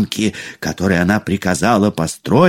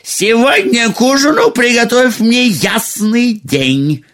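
high-pitched man-eater giving orders; ogress queen voice; 13 semitones higher than usual. A narrator's reading. Ogress ordering her supper from the cook, in a high commanding voice. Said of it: "она опять позвала повара".

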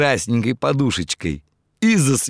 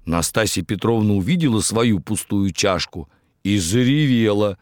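ordinary delivery

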